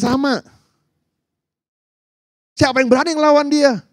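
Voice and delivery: high-pitched, agitated protest; alarmed soldiers. Acted. Israelite soldiers telling David about Goliath, alarmed. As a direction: argue back high and worked up.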